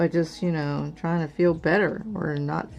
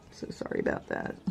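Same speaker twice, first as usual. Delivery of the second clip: whispered apology; 9 dB quieter, faster than usual. Real, not acted. Apologizes while getting emotional.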